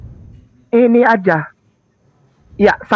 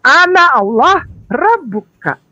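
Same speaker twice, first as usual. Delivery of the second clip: slow reading; 3 semitones higher, slower than usual. Shown as a demonstration. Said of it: "kita ambil itu tiga kata".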